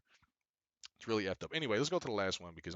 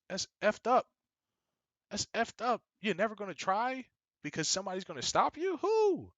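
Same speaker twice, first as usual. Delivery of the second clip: high, loud outraged rant; 12 semitones higher, 8 dB louder than usual. Real, not acted. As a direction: shout out the disbelief.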